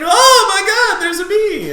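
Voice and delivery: put-on very high, loud shriek as the girls; over-the-top falsetto panic; mock panicked scream. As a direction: scream it in hammed-up terror. Acting out the panicked honeys.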